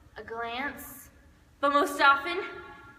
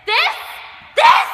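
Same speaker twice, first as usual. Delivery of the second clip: rising, much louder, higher yell; outraged shout; 13 semitones higher, 14 dB louder than usual. Acted. Duet actor shouts in mock outrage. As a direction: blast the word out indignantly.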